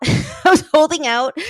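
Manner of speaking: laughing. Real, not acted. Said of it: "So I was holding out".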